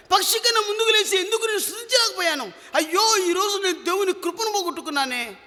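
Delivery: high-pitched, acted wail of regret; weeping lament. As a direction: cry out in repentance, voice held high. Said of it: "ఆ విధంగా ఏడుస్తున్నాడు".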